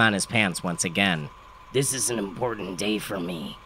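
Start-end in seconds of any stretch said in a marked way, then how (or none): 1.7-3.6 put-on soft, gravelly older man's voice; gravelly tenor. A narrator's reading. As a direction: rasp it quietly like a worn-out father. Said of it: "he said in a gravelly tenor".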